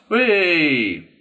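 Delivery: high, loud, drawn-out whee; long falling party whoop; merry shriek. A narrator's reading. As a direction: squeal with the merry crowd. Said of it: "shrieks all the merriment".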